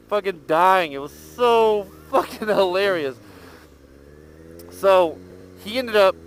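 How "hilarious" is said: ordinary talk, nothing marked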